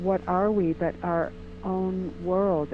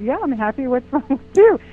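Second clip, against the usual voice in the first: baffled retort; 6 semitones higher, 10 dB louder than usual. Retold or imitated. Retold puzzled reply to stranger.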